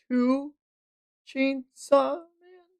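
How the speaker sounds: high, soft, slow disbelief; high soft drawn-out question; stunned devil. Acted. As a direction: ask it in shock. Devil surprised.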